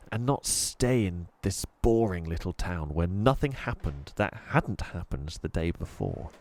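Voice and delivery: usual speaking voice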